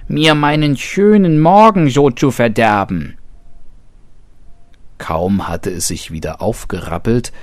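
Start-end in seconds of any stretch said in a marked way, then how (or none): 0.1-3.2 high, loud indignant squeal; shrieking. A narrator's reading. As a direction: squeal out the spoiled morning like an offended hare. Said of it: "kreischte das Häschen".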